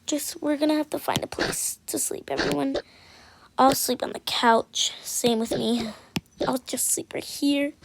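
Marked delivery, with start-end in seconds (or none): none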